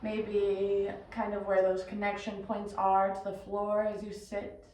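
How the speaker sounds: measured meditation guide, long pauses between phrases; guided meditation. Acted. Yoga teacher guiding listeners into breath and body, guided meditation. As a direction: lead them inward unhurriedly, letting each instruction settle.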